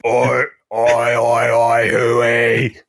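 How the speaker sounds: loud rough call of oi; Butcher calling out. Acted. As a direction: call out across the room. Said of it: "Imagine the dialogue in this show".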